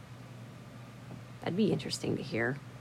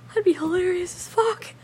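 high-pitched, barely holding back laughter; cracking up; 12 semitones higher than usual. Real, not acted. Picturing them dancing the polka, cracking up. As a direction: squeal it out, then dissolve into laughter.

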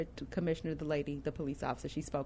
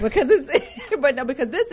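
laughing, higher and louder; 6 semitones higher, 4 dB louder than usual. Real, not acted. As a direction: laugh through the words. Laughing while speaking.

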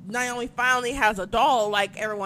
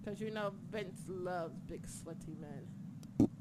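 hushed aside; 5 semitones lower, 16 dB quieter than usual. Acted. Mocking Vince's tastes.

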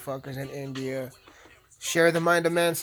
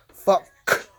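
loud exclamation; 5 semitones higher, 10 dB louder than usual. Real, not acted. Sudden outburst mid-sentence.

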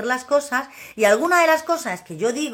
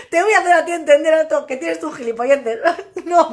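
laughing; 4 semitones higher, 4 dB louder than usual. Real, not acted. Laughing at her own remark.

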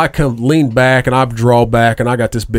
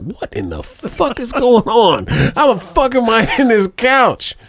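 much higher, slow bewildered cry; retold panic; 11 semitones higher, slower than usual. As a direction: act out waking up confused and alarmed. Waking up trapped in couch.

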